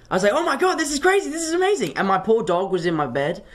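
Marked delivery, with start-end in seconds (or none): 0.4-2.0 much higher, thrilled exclamation; retelling his screaming with delight; excited shouting. Retold or imitated. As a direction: let the joy burst out high. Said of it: "I was screaming and shouting".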